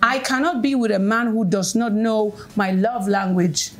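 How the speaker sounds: ordinary talk, nothing marked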